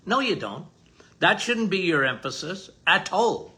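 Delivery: ordinary delivery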